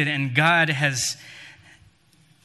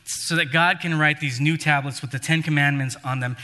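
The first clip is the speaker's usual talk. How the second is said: normal talking voice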